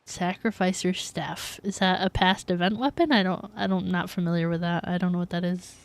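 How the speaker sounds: ordinary talk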